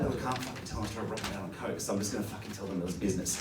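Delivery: soft, fast, secretive muttering; mock guilty confession; sneaky aside. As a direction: mutter it quickly under the breath. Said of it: "he's like".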